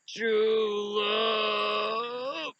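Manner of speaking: high, soft, long-held wail; drawn-out groaned quote. Acted. Dying hero answering in a movie scene, faint drawn-out wail. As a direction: stretch it out slowly, high and faint.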